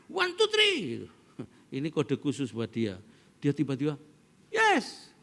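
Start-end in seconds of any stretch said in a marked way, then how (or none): 0.1-0.8 high-pitched call from the stands; cheering shout. Retold or imitated. Father signals his son from the stands, cheering shout. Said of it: "Dan teriakku begini". 4.5-5.1 short, high-pitched yell; excited cheer. Retold or imitated. Father reacts to his son on court, excited cheer. Re-enacted cheer at son's game.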